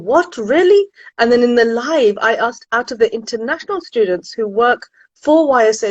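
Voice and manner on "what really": surprised. Retold or imitated. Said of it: "I thought".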